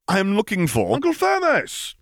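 high, put-on voice insisting; indignant admiral. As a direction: stand up to the waitress. Piet voiced, far timbre.

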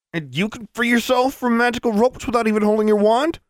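high, straining snarl; snarling gasp. A narrator's reading. Bound boy challenging girl, snarling and gasping. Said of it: "somewhere between a snarl and a gasp".

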